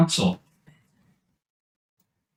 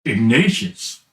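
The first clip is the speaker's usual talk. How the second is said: low haunting voice; 8 semitones lower than usual. Acted. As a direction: call the name from the dark, low. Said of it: "you hear a deeper voice".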